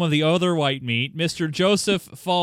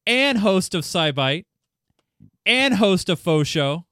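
announcer reeling off credits, each title set apart; mock-grand introduction; 3 dB louder, slower than usual. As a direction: hit every "and host" like a ring announcer. Grand list of guest's shows.

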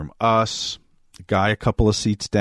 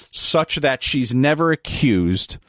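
normal talking voice

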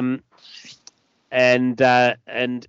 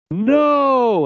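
much higher and louder drawn-out wail; anguished scream; 7 dB louder than usual. Acted. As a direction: scream it as everything breaks. Jenny's despairing scream.

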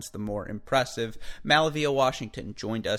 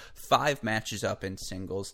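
ordinary talk, nothing marked

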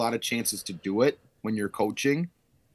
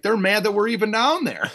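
mock indignant aside; 11 semitones higher, 5 dB louder than usual. Coach telling players, joking.

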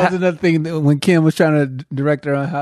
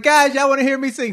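higher, eager offer; mock eager; 8 semitones higher than usual. Pat asking the audience, higher and eager. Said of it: "she'd be like".

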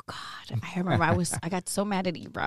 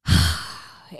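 long, loud exhale; heavy sigh; 6 dB louder than usual. Real, not acted. Guest asked for her wildest moment, long heavy sigh. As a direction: let out a big breathy sigh. Overwhelmed by the question.

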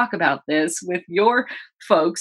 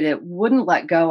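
ordinary talk

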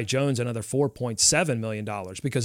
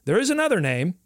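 higher, hammed-up game show pitch; salesman pitch; 6 semitones higher than usual. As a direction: sell it like a showroom host. Building up the next name.

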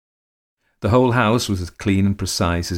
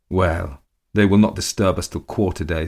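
plain reading voice

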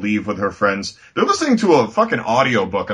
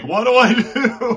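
high, slow laughing plea; hammed-up high-pitched bewilderment; mock helpless actor; 11 semitones higher, slower than usual. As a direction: draw it out as a lost actor's plea. Actor helplessly asking the PAs.

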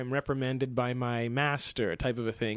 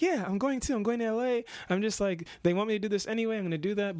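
higher, excited self-quote; mock eager; 8 semitones higher, faster than usual. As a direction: pump yourself up, higher pitched. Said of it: "I'd be like".

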